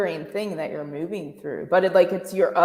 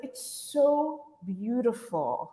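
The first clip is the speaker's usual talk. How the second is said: tearful awe; 3 semitones higher, 6 dB quieter, much slower than usual. Retold or imitated. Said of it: "I cried and I was like".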